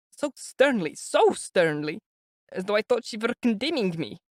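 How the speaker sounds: high, anxious mother's voice; an older woman fretting before meeting her son; high, worried mother voice; fretful mother. A narrator's reading. Pulcheria recounts her dream.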